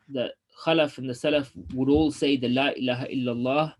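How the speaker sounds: ordinary talk, nothing marked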